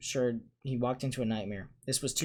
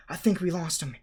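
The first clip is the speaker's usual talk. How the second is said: panting; 6 semitones higher, 3 dB louder than usual. A narrator's reading. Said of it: "Harry panted".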